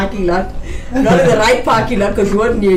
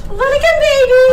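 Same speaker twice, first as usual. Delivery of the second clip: high excited squeal; excited shout. Real, not acted. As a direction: call out with delight.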